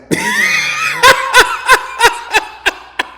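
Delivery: high, loud peals of laughter. Real, not acted. Laughing after introducing the bottom bitch, high loud laughter. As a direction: crack up at your own story.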